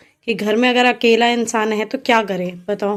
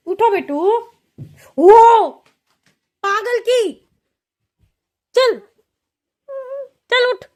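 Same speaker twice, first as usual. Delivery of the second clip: higher, louder urging call; telling her to get up; 11 semitones higher, 5 dB louder, much slower than usual. Real, not acted. Mother urging toddler to get up, high loud call.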